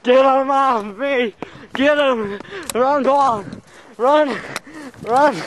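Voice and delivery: very high, frantic yelps; panicked screaming. Acted. Friend swarmed by bees, high panicked yelling. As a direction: shriek for help while fleeing. Attacked by bees while running.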